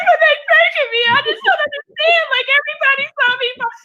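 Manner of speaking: very high, fast whimpering mock voice; whiny plea. Comic retelling of her own near-tears, high whine. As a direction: whine like a kid about to cry. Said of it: "I'm like".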